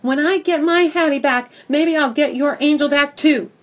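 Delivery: loud, high rush of power; surge of power. Acted. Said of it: "feeling a surge of power".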